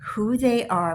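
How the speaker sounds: ordinary talk, nothing marked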